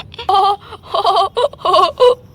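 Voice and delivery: long bursts of "ho ho" laughter; hearty laughing. Acted. Uncle laughing at the girl, hearty ho-ho. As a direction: laugh hard in amused surprise. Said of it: "आप इतना ज्यादा क्यों हंस रहे हैं".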